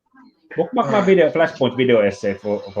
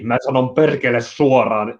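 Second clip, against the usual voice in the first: native Finnish pronunciation; straight Finnish. Shown as a demonstration. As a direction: say the line in plain, direct Finnish. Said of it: "in straight Finnish".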